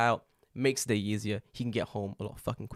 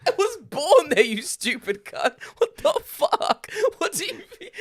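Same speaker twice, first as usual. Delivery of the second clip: high, screamed comic outrage, laughing through it; exasperated rant; 9 dB louder, slower than usual. Retold or imitated. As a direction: shriek in disbelief, half laughing. Escalating outburst at leaflet man.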